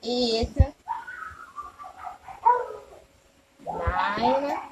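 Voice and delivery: put-on long pauses between numbers for kids; slow counting. Shown as a demonstration. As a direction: count slowly and wait for the class. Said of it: "Can you count them with me".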